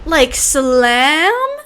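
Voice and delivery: "slam" stretched out, rising sharply at the end; questioning slam. Acted. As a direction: ask it with a long, climbing "slam". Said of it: "What kind of slam?".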